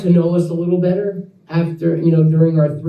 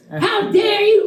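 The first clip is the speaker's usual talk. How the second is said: mock outrage; 12 semitones higher, slower than usual. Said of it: "grilling me with his finger in my face".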